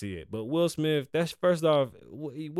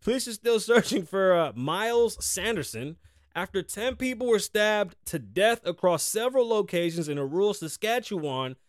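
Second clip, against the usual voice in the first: a bit higher, louder news-reading voice; 8 semitones higher, 4 dB louder than usual. Acted. Host reading the stabbing report to listeners in a newsy tone. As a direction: read the story like a lightly mock anchor. Reads a news article aloud.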